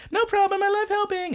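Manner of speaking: very high, sugary squeak; very high eager girl to the teacher; overeager anime girl. Retold or imitated. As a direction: squeak it out, far too eager. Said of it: "And she's like".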